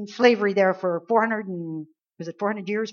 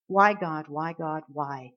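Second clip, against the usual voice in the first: mild, sinking plea, softer on each why; dejected pleading; 5 semitones lower, 4 dB quieter, slower than usual. Acted. Believer asking God why, dejected and fading. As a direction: let each why drop lower and fade. Acts out weary complaint to God.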